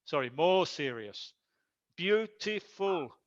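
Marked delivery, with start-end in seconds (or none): 2.0-3.1 "Beautiful" slowly, in three beats, beau-ti-ful; syllable by syllable. Shown as a demonstration. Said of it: "three syllables".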